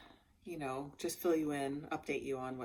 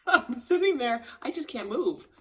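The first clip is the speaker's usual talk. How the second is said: ordinary talk, nothing marked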